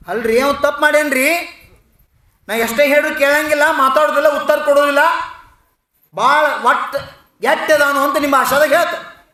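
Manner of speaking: high, loud, heated complaint; indignant protest. A narrator's reading. Teacher complains about the pupil to principal, indignant. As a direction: argue back loudly in exasperation.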